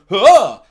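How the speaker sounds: loud, sudden mock grunt of surprise; a villain's startled huh, tossed off; surprised huh. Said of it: "looked up at the sky and gone".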